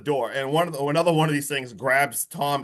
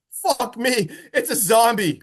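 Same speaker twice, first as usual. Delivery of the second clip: higher, laughing through the line; 7 semitones higher, 3 dB louder, slower than usual. Retold or imitated. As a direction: crack up while quoting it. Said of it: "exact line is".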